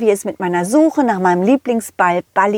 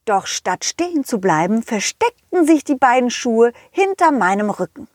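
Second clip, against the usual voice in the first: usual speaking voice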